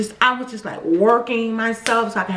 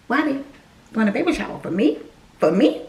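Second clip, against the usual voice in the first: ordinary talk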